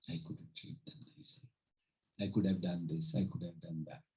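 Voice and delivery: hushed, low imitation of regret; quiet mock regret. Speaker imitates someone regretting past treatment of loved ones, hushed and low. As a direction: voice a mourner's regrets softly, almost to yourself. Voicing a mourner's future regret.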